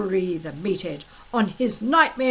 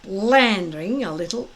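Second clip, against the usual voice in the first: ordinary delivery, nothing marked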